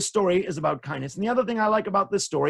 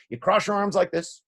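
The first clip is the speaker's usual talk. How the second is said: ordinary delivery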